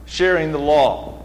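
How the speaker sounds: ordinary talk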